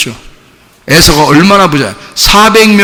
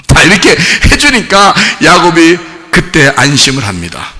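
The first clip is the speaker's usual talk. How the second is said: normal talking voice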